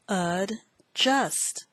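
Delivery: "adjust" split into ad, then just; syllable by syllable. Shown as a demonstration. Teacher introduces word four, "adjust" broken in two. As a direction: say "adjust" with a clear pause between syllables.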